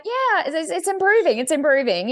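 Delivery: high-pitched, unconvincing reassurance; retold sheepish, sing-song reply; joking reassurance. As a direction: pitch it up, playing along with the joke. Joking reply about her German.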